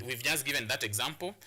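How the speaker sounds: usual speaking voice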